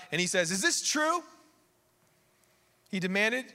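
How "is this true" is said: higher-pitched, indignant demand; worked-up royal questioning; fired-up king. Acted. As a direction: act a mildly fired-up king confronting his subjects. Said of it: "he starts getting fired up".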